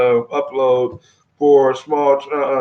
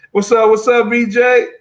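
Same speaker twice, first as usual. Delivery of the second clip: higher, louder hype greeting to a viewer; excited greeting; 8 semitones higher, 5 dB louder than usual. Real, not acted. Streamer greets viewer on live, excited hype greeting. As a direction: give the viewer a big hyped-up hello.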